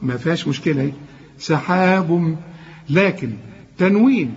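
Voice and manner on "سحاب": سحاب slow, all letters light; light recitation. Shown as a demonstration. Teacher reciting a word with only light letters. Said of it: "كل الكلمة مرققة".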